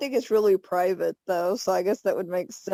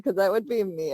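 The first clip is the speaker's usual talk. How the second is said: usual speaking voice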